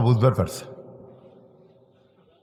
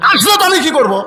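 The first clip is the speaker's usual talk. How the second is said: high, louder shrill-voiced wife; angry wife shouting from the bed; wife yelling; 10 semitones higher, 4 dB louder, faster than usual. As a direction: snap back at the husband. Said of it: "বউ চিল্লায়".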